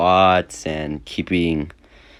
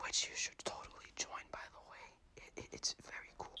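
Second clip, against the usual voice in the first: whisper; 27 dB quieter, faster than usual. Real, not acted. Aside plugging Discord server.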